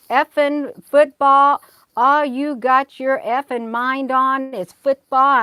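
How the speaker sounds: imitating a furious mother swearing, drawn out; retold angry rant. Mother cursing at her child in the car, mock angry tirade. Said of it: "And she was saying".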